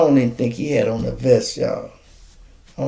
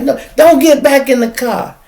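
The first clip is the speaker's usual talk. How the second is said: high, loud cop command; imitated shout; 13 semitones higher, 8 dB louder than usual. Cop yelling at the woman not to get back in, loud high shout.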